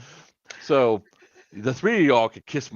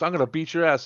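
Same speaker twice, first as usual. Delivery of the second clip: retold threat; 7 semitones higher than usual. Retelling the crazy woman's threat, mock-angry. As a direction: imitate an irate woman, pitched up.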